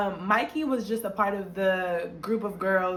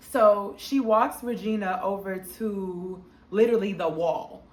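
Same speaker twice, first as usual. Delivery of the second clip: normal talking voice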